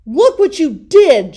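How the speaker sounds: put-on high, very loud furious yell in a boyish voice; angry shout. Brother yelling at Fred after the crash, furious shout. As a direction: blast it at him. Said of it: "shouted Brother".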